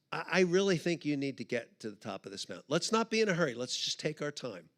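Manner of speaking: low, soft, patient voice; calm a struggling friend quietly; gentle reassurance. Retold or imitated.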